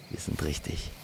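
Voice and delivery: plain reading voice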